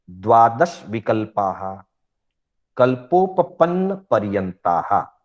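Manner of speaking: slow recitation in short, separated pieces; sutra recitation. Shown as a demonstration. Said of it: "छह सूत्रों का उच्चारण आपके सामने करूंगा". (सूत्र का धीमा, खंडों में उच्चारण)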